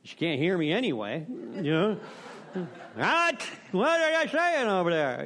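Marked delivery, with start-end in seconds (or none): 2.9-5.2 put-on high, louder confused old lady asking what; a hard-of-hearing old lady straining to catch his words; mock old lady, hard of hearing. Old lady to him, mock confused high what.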